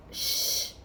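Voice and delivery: a sharp breathy shush to silence them; whispered shush. A narrator's reading. Hagrid hushing the children in the library, sharp unvoiced shush.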